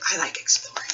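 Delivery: soft whisper, half to herself; whispered aside. Real, not acted. Teacher whispering a personal aside to the kids mid-story. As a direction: slip it in as a hushed personal aside.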